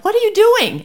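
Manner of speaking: high, loud alarmed outburst; startled cry of disbelief; shocked question. Retold or imitated. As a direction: blurt it out in alarm. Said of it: "And Jesus is like".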